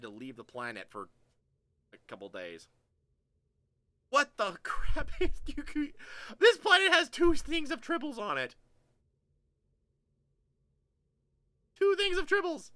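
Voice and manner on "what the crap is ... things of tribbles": very high, loud disbelieving squeal; incredulous outburst. Real, not acted. Player finds two things of tribbles on a planet, incredulous outburst. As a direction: cry out in high-pitched disbelief. Outraged reaction to tribbles in game.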